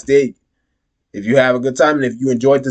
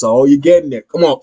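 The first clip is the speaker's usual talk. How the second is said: ordinary talk, nothing marked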